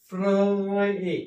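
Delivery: फ्र ए split and drawn out slowly; sounding out. Shown as a demonstration. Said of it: "काय उच्चार होईल?". (फ्र ए सावकाश सुटे उच्चार)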